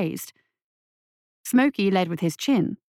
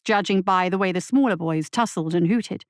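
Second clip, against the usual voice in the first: ordinary delivery, nothing marked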